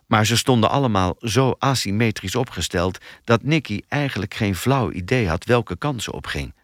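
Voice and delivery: ordinary delivery, nothing marked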